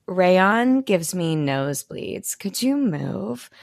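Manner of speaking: slow, dry, unimpressed; dry disdain. Retold or imitated. Luna dismissing the classmate, slow and dry. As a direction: drawl it out, utterly bored. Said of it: "Luna's like".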